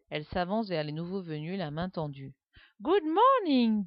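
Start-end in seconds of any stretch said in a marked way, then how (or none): 2.8-3.8 put-on slow, high English-accented greeting; strong English accent. A narrator's reading. Alice greeting the newcomers, strong English accent. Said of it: "avec un fort accent anglais".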